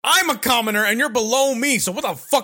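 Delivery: high, loud indignant comeback; acted defiant retort. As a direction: throw it back at them forcefully. Acted defiant answer to villains.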